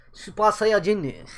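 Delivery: put-on Japanese accent. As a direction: say Super Saiyajin like a Japanese anime fan. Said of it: "Did I just say that in a Japanese accent?".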